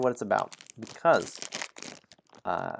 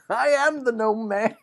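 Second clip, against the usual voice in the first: squeaky high gnome voice, loud; gnome mayor; 13 semitones higher, 7 dB louder than usual. Acted. Gnome mayor proclaiming himself to the players, high and loud. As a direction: boom out as a tiny proud mayor.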